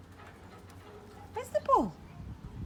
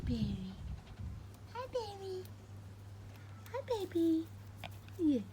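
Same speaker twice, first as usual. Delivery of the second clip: usual speaking voice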